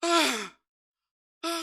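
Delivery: high, strained intake of air; laboured gasp. Retold or imitated. Showing the congregation his struggle, laboured gasping. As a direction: fight to pull a breath in. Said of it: "Untuk ngambil tuh gini".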